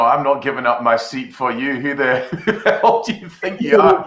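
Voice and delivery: laughing mock outrage. Host laughing as he voices an offended girl, mock outrage. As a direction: play an offended clubber, laughing through it. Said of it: "someone just going like".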